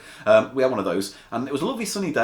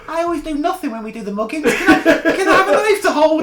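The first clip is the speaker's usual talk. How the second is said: high, squeaky put-on voice, rising louder; whiny small mugger; 7 dB louder than usual. Smallest mugger begging the gang for a turn, whiny squeak. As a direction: plead in a squeaky little henchman voice. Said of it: "He must have been beforehand going".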